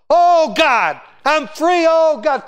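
high and loud, overcome with joy; ecstatic cry. Retold or imitated. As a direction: cry out as if just freed. Imitating Prather's ecstatic holy stomp.

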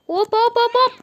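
urging the character on in a rising shout; frantic shouting. Real, not acted.

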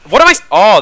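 sudden loud startled cry. Real, not acted. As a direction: jolt of surprise, loud. Startled by a wall in game.

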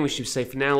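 usual speaking voice

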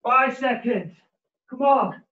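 ordinary delivery

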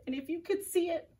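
ordinary delivery, nothing marked

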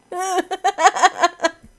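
loud, high burst of laughter; laughing. Real, not acted. Player laughing at own posh act.

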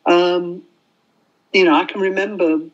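ordinary talk, nothing marked

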